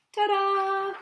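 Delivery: high, loud showman's ta-da; triumphant ta-da. Acted. Cheese showing off to the audience, triumphant. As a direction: announce yourself like a magician's reveal.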